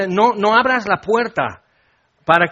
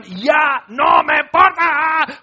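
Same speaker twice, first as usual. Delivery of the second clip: high, loud, drawn-out angry yell; re-enacted furious outburst; angry shouting; 8 semitones higher, 4 dB louder, much slower than usual. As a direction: shout it like a spouse mid-fight. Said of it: "tan enojados".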